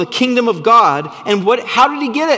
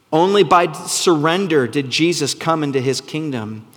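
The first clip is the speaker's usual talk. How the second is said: ordinary delivery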